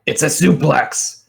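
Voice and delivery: high, triumphant wrestler's call; yelled out. Acted. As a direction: shout the move's name mid-match. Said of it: "she yells out".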